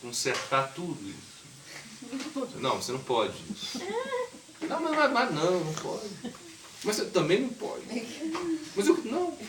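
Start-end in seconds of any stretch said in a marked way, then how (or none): 4.7-9.4 mock child protest. Child arguing with mother.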